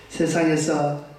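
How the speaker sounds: ordinary talk, nothing marked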